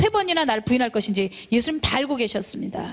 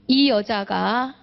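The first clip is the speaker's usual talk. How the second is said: usual speaking voice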